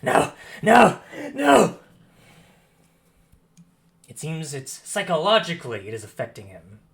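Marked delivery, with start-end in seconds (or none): 0.0-1.8 breathy cries rising to a loud, high scream; screamed denial. Acted. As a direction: scream each no, building louder and higher. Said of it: "he begins to scream".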